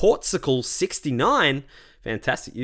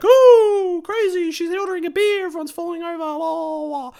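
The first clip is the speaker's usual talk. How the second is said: very high hammed-up gasping crowd; mock astonishment; 6 dB louder than usual. Bar crowd amazed at her beer order, hammed-up high shock. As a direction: squeal in exaggerated shock, dissolving into silly babble.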